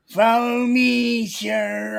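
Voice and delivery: put-on feeble old clerk. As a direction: drag it out like a doddering old clerk.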